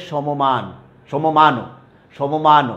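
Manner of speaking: সমমান with an extra vowel, "sho-mo-maan"; mispronounced without hasanta. Shown as a demonstration. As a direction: open up the first m of সমমান into "mo". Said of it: "হসন্ত চিহ্ন না দিই তাহলে হয়ে যাবে".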